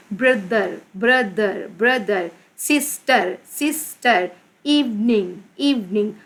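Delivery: "Brother", "sister", "evening" each split into two beats; two syllables. Shown as a demonstration. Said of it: "pronounced in two parts". ("Brother", "sister", "evening" दो हिस्सों में तोड़कर)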